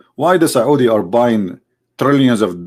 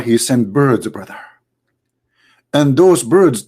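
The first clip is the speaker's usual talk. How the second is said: usual speaking voice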